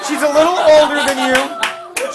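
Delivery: high, loud line through a laugh; laughing pitch. Retold or imitated. Townsfolk offering Oedipus the older queen, laughing. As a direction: sell it while cracking up.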